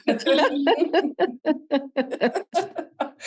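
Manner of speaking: high, breathy nervous giggle; nervous laugh. Real, not acted. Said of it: "It's nervous laughter".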